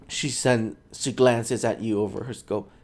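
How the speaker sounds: ordinary talk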